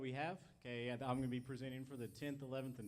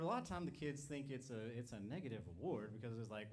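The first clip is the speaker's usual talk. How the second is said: ordinary delivery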